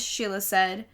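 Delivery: usual speaking voice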